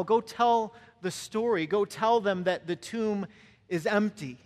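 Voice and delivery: loud, drawn-out preacher's charge; impassioned preaching. Real, not acted. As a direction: send them out with emphatic, punchy phrases.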